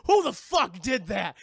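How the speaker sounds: loud, slow, menacing challenge; angry demand. Retold or imitated. Monster hearing glass crunch, loudly demanding who did it. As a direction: bark it out at the intruder. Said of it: "she's like".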